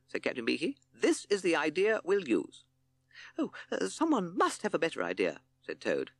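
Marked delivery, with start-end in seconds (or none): 0.9-2.6 high, slow, deliberate captain; firm leader. A narrator's reading. Captain overruling for lack of a better idea, firm. 3.4-5.4 high, dithering toad voice; anxious toad. A narrator's reading. Toad begging for a better idea, anxious.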